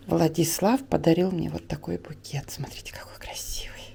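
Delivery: whispering. Real, not acted. Showing bouquet, quiet aside.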